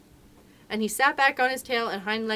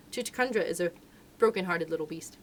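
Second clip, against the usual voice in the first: plain reading voice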